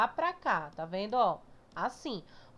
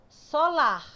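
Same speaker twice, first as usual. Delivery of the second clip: "Solar" higher and louder, with a strong LAR; so-LAR, final syllable leaned on hard; stress on LAR; 5 semitones higher, 6 dB louder than usual. Shown as a demonstration. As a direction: punch the last syllable of "Solar". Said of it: "como é mais forte".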